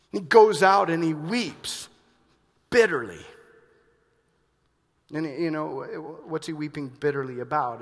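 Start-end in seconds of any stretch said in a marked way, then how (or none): 0.1-3.2 forceful emphasis. Real, not acted. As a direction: lean hard on each word. Emphatic loud sermon point.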